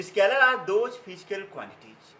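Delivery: very slow, spaced teacher dictation with long pauses. Acted. Teacher dictating the scalar definition to students, word by word, very slowly. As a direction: read it out slowly so students can write.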